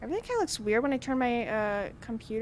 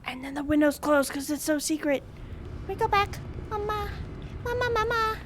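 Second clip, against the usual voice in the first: put-on scared child; 7 semitones higher than usual. Playing a frightened child.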